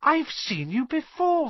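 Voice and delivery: put-on very high, slow boy's voice; young boy's recognition. A narrator's reading. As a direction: say it slowly, as a boy recognising someone. Harry voiced as a child.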